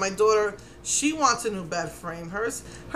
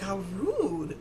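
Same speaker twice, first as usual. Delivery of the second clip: indignant aside; 7 dB quieter than usual. Retold or imitated. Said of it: "I was so mad".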